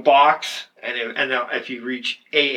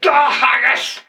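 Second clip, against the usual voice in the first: loud, high Scottish interjection; Scottish accent; 9 semitones higher, 5 dB louder, slower than usual. Acted. Co-host heckling an England story, loud Scottish accent. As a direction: burst in as a comic Scot. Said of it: "with that Scottish accent".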